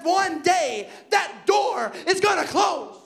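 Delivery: slow, drawn out warning; slow preaching emphasis. Acted. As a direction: stretch it out like a warning. Preaching climax.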